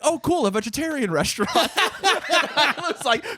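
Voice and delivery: high, laughing naive voice. Retold or imitated. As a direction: giggle through the naive thought.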